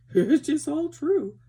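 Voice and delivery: high, slow, put-on pony voice; Merrylegs's voice. A narrator's reading. Merrylegs confirms the story, high slow pony voice. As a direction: pitch it well up and draw it out. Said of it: "said Merrylegs".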